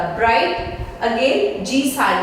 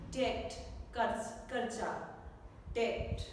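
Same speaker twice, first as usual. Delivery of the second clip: usual speaking voice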